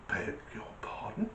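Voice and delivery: whispered, affronted query; acted hushed incredulity; stunned disbelief. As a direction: breathe it out as if you misheard. Visitor stunned by rude translation.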